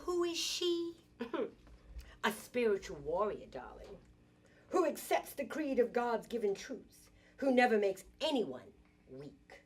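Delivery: slow, swinging spoken-word delivery with punched openings; dramatic poem declamation. Acted. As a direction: proclaim it proudly, each phrase set apart. Declaims her poem to the meeting.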